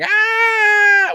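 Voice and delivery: very high, loud cartoon yell; cartoon scream. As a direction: scream like a running CG figure. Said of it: "running across and going".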